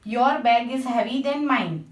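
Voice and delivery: ordinary talk, nothing marked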